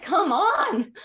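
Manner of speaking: loud, high, fed-up cry; exasperated come on. Retold or imitated. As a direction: throw up your hands and shout it. Exasperated mom, daughter still constipated.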